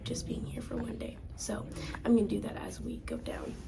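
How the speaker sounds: soft whisper in a tunnel. Real, not acted. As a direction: hush it, barely voiced. Hushed talk in a tunnel.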